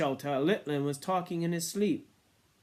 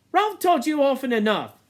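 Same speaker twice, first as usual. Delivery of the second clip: sulky, high complaint; moody; 12 semitones higher, 11 dB louder than usual. A narrator's reading. Piggy reproaches the twins, moody. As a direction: grumble it. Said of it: "said Piggy moodily".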